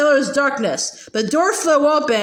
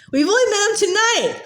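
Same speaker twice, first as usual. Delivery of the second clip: much higher, a lightly sobbed plea; sobbing; 7 semitones higher than usual. A narrator's reading. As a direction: plead through light tears. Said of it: "Hermione sobbed".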